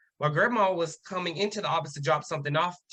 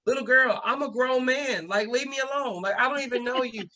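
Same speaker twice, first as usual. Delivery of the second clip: higher-pitched exasperated protest; retold indignant brush-off, pitched up; exasperated retort; 6 semitones higher than usual. Grown student telling a little girl to leave him alone, exasperated.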